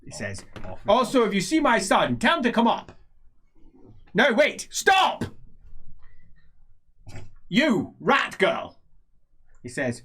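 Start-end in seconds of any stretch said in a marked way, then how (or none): 0.9-2.9 loud, high, commanding character voice; raised, imperious voice; old nobleman giving orders. Acted. Same character gives orders. 4.1-5.2 high, loud acted shout; sharply raised voice on the final word; urgent call to halt. Character halts someone leaving. 7.5-8.8 loud, slow, raised call; slow, loud address in character; imperious summons. Acted.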